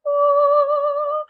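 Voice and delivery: high, loud, held "ahh"; heavenly "ahh" effect. Shown as a demonstration. Vlogger imitating a light-beam reveal, high sustained "ahh". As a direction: angelic reveal chime done by voice. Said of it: "do a thing where it's like".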